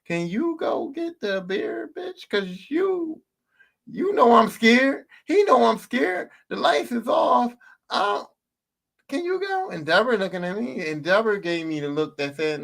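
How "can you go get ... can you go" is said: put-on high, whiny begging to the older sister; a scared boy begging his sister to fetch dad's beer; scared pleading. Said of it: "and I'm like".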